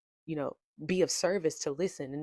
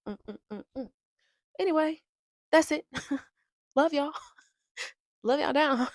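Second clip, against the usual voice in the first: usual speaking voice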